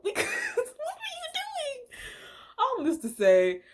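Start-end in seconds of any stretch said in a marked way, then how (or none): 0.0-2.0 very high, laughing through the words; high incredulous laugh; laughing disbelief. Real, not acted. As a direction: giggle out the question.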